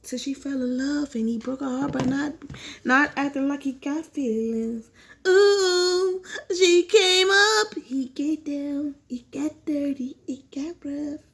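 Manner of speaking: slow, hushed, teasing gossip about a steamy affair; sultry gossip. Acted. Vlogger telling viewers the professors' love story, sultry and hushed. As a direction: drag it out like juicy scandal.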